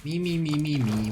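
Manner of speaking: high mocking "me, me, me"; high, sliding down into a jeer. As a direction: mimic whining in a run of repeated sounds. Mocking the game's characters.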